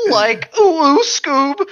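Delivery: high loud Shaggy woo. Shown as a demonstration. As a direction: whoop it like Shaggy. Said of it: "Say a woo in that voice".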